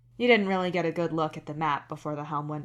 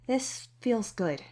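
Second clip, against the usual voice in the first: tender thought; 5 semitones higher, slower than usual. A narrator's reading. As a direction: linger on feels, savouring the hug. Tender inner thought during hug.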